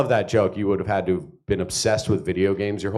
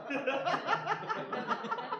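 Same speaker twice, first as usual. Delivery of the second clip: high, soft, breathless giggle; giddy stoned giggle; 13 dB quieter than usual. Acted. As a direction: giggle like the high just hit. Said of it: "impression of the moment".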